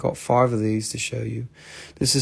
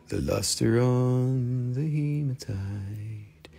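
soft, very slow put-on voice; put-on seller voice; 8 dB quieter, much slower than usual. Repeating the line to viewers in a soft, slow put-on seller voice. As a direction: coo it slowly like a different person.

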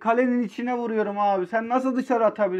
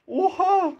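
shocked; 8 semitones higher than usual. Real, not acted. Shocked exclamation.

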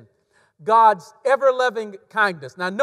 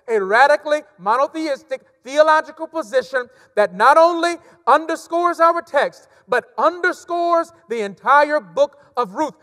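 louder, driving preacher's cadence; preaching at full force; 5 semitones higher, 5 dB louder than usual. Acted. Pastor building the point to the congregation, full preaching force.

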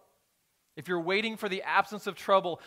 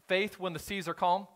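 normal talking voice

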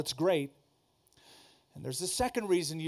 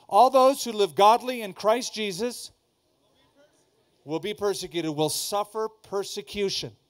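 higher, louder proclamation of the verse; full-voice verse; 3 semitones higher, 6 dB louder than usual. Shown as a demonstration. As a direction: belt the verse out for them. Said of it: "does anybody know that verse".

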